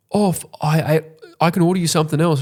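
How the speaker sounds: high, stumbling, hurried offer; flustered offer. Acted. Guilted friend offering to order food, flustered. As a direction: stammer upward into a guilty offer.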